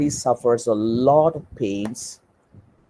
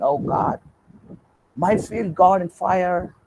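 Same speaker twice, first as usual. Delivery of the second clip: higher, half-acted cry of dismay over the burnt field; mock wailing yell; 7 semitones higher than usual. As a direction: landlord wails as a worker reports his field burning, mock distressed yell. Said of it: "shouting and yelling and saying".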